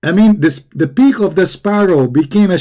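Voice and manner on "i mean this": usual speaking voice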